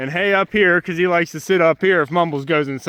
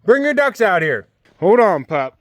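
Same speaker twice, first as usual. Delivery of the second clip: ordinary talk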